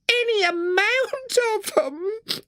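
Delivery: slow, high falsetto mock sob; a hammed-up washerwoman's sob. A narrator's reading. Toad fakes tears to win the driver, high falsetto mock sob. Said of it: "sobbed Toad".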